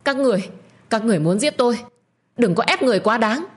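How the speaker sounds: high, frightened protest; panicked plea. Acted. Tiên being forced to drink poison, panicked high plea. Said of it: "Tiên hoảng sợ lắc đầu nguầy nguậy".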